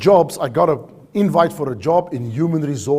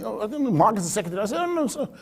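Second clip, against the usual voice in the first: quick, softer, higher flustered answer; flustered reply; 5 semitones higher, 7 dB quieter, much faster than usual. Retold or imitated. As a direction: play the stunned new hire stammering to the boss. Flustered newcomer answering screaming boss.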